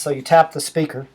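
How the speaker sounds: ordinary talk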